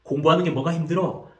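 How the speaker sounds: put-on exasperated parent lecturing a tired kid; aggressive scolding parent. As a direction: nag the child as if study were easy. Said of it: "이렇게 공격적으로 말하는 경우가 많습니다".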